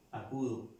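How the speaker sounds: usual speaking voice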